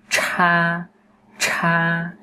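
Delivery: "Cha" with a burst of breath; aspirated retroflex. Shown as a demonstration. Teacher drills breath release, "Cha" strongly aspirated. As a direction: puff air through a high level "Cha". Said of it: "nhớ âm này bật hơi nhá".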